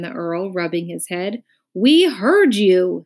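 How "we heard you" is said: slow, loud, emphatic annoyance; angry. A narrator's reading. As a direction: drag it out crossly. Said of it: "said the minister angrily".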